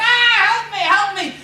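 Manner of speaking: high, loud yelling dwarf; dwarf crying for help. Acted. Trapped dwarf calls to the girls, loud high cry. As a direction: wail for help like a trapped little man.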